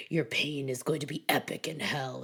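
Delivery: lightly voiced low throaty villain line; a hint of a growly horror villain, low and throaty. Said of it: "low, throaty".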